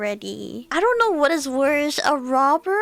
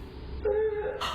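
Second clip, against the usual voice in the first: startled gasp; 4 semitones higher, 10 dB quieter than usual. Real, not acted. Sees patient scream.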